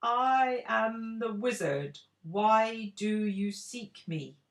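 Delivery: slow, booming echoing voice. A narrator's reading. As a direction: boom it from an empty room. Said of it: "a voice echoed".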